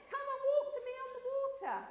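high, quick acted summons; lightly voiced beckoning call; Jesus calling out. Jesus calling Peter over, acted.

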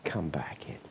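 whispered softly, begging; a father whispering to his son to come inside; pleading whisper. Acted. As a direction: beg him back in, in a whisper. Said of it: "pleaded with him, begged him".

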